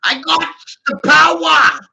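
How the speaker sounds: high, loud gloating cry; triumphant shout. Retold or imitated. Gloating over the people trapped by his money, triumphant yell. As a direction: crow it like a villain who has won. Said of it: "I'm like".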